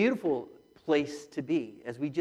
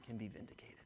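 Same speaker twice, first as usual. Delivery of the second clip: whisper; 5 semitones lower, 16 dB quieter, faster than usual. Real, not acted. Hushed close of sermon point.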